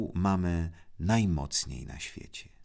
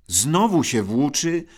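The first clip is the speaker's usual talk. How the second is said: higher, louder outraged shout; indignant cry; 8 semitones higher, 8 dB louder than usual. A narrator's reading. Brother shouts, outraged at his idle older brother. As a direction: burst out in indignation. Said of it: "zawołał oburzony brat".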